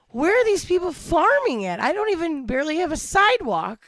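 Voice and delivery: high-pitched baffled outburst; mock disbelief, pitch leaping up; incredulous. City person baffled that people farm, incredulous. Acting out own baffled reaction.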